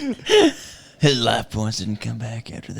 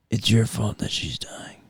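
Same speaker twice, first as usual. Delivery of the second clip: whispered, hushed; 8 dB quieter than usual. Acted. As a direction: breathe it as a secret. Conspiratorial skit line.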